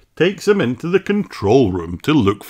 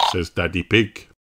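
ordinary delivery, nothing marked

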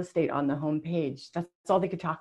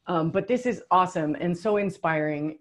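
ordinary talk

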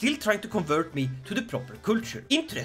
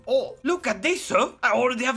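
ordinary talk, nothing marked

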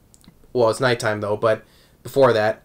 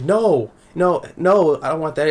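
raised-pitch imitated protest; flustered refusal; 5 semitones higher than usual. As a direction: hurry to deny it in a higher acted voice. Acts Hisui's flustered refusal.

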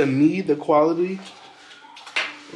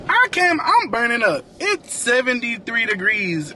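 exasperated; 9 semitones higher than usual. Real, not acted. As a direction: complain about the heat. Complains about the heat.

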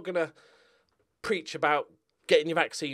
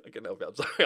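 ordinary delivery

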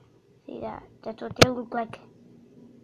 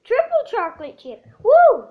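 excited; 8 semitones higher, 13 dB louder than usual. Real, not acted. Excited correction, cheering.

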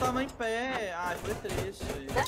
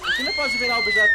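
high, fast excited yell; excited shout; 7 dB louder, faster than usual. Real, not acted. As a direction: burst out in excitement. Excited question mid game.

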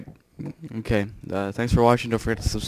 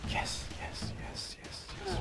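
whispered yes; 17 dB quieter, slower than usual. Real, not acted. Quiet celebration after the win.

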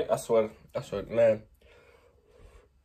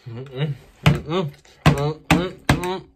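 pained hums; 5 semitones higher, 10 dB louder, much slower than usual. Real, not acted. Eating fiery noodles.

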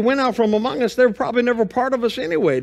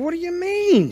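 puzzled; 10 semitones higher than usual. Retold or imitated. Puzzled son answering his mom.

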